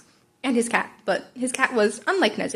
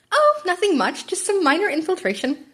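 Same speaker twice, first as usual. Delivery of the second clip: playful principal Nezu; 8 semitones higher than usual. A narrator's reading. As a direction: tease the tired teacher, lilting. Nezu voiced.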